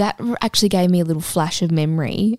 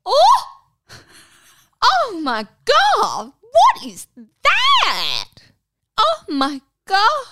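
high, loud, drawn-out shrieky gasps of horror; mimicked shock; 6 dB louder, much slower than usual. Roommate discovering the toy on the floor, mimicked shock. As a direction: imitate someone aghast, stretching each word way up high. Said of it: "she went like this".

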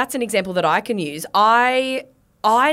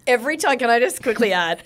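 ordinary talk, nothing marked

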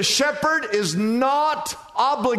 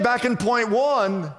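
ordinary talk

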